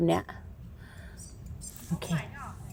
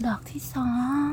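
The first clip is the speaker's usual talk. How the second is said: whisper; 10 dB quieter than usual. Shown as a demonstration. Whispering the count to viewers while mushroom hunting. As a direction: whisper the count, softer and toneless.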